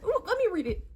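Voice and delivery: mocking a friend begging to read it; eager friend. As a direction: imitate the friend's eager squeal. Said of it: "And you're over here".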